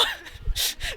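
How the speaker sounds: a high, unvoiced half-laugh, half-sob; remembering crying in art class, laughing it off; breathy laugh-cry. Real, not acted. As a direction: laugh through a near-sob. Retelling a crying fit in class.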